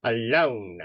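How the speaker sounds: mild booming command, words set apart; booming professor. Acted. Professor shooing students away from the first years, booming. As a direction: order them off with a lightly boomed voice. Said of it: "his voice booms, goes".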